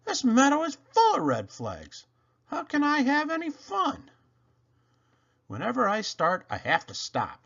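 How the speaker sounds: high, whiny little engine; frustrated Toodle. Acted. Toodle stuck among red flags, high whiny complaint. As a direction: complain close to tears.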